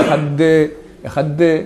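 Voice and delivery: Ehad de with a separate de syllable; wrong split Ehad. Shown as a demonstration. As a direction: show the mistake, Ehad de. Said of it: "ne pas dire comme ça". (Ehad de, erreur)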